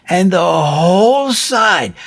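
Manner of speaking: ordinary delivery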